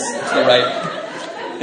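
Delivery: ordinary talk, nothing marked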